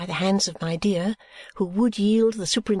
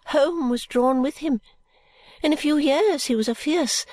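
put-on high, fluttery old lady, whispering bits; a rambling old woman clinging to hope; old Miss Flite; 4 semitones higher than usual. Miss Flite tells her case.

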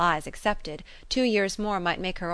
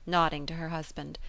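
plain reading voice

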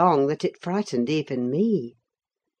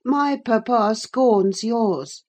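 put-on slow, raised boy's taunt; crying out scornfully; 6 semitones higher, 4 dB louder, slower than usual. A narrator's reading. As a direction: draw out a mild, scornful cry. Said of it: "cried Linton".

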